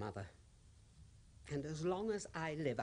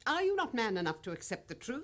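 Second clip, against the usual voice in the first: sharp and higher, louder challenge; scornful rebuke; 9 semitones higher, 5 dB louder, faster than usual. Acted. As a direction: throw it back at him with scorn. Widow rebukes grieving father.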